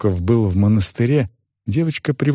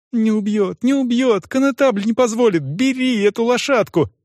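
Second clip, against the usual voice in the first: high-pitched urgent pleading; eager, anxious persuasion; insistent girl; 10 semitones higher than usual. A narrator's reading. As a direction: press him in a high girlish voice. Girl reassures and urges groom.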